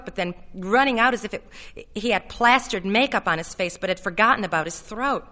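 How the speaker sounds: ordinary delivery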